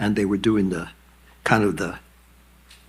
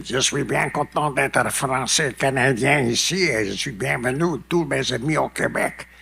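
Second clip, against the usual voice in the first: Québécois French, lightly voiced, higher; Quebec French; 4 semitones higher than usual. Quebec people greeting the audience in their French. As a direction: speak as the locals would. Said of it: "the other people would say".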